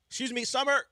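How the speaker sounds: calling out loudly across the room; yelling. Real, not acted. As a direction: shout to get her attention. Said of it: "I have to yell at my intern".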